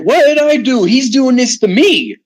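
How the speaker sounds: high-pitched, exasperated self-defence; retold protest. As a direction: protest your innocence, pitch jumping up. Retelling own protest to accusers.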